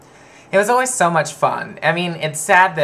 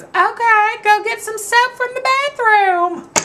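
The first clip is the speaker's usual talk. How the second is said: high, slow sweet Southern lady; grandma voice; 5 dB louder, slower than usual. Retold or imitated. Grandmother slyly questioning the boy, high and slow. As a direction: ask it slowly and sweetly, laying a trap. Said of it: "And she goes".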